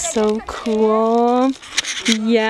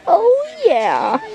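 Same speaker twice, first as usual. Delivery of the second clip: usual speaking voice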